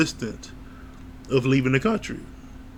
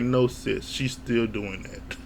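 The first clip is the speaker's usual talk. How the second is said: much softer, slow and low; deadpan aside; 3 semitones lower, 7 dB quieter, slower than usual. Retold or imitated. Telling the sister, to the screen, in a quiet deadpan. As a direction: drop it flat and quiet, slowly. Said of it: "And I'm like".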